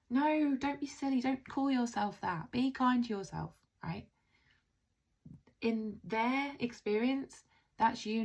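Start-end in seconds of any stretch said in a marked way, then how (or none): 0.1-3.5 high, chiding mock reassurance; dismissive reassurance. People who don't listen answering self-criticism, mock dismissive reassurance. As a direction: imitate a well-meaning friend brushing it off. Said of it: "They will go".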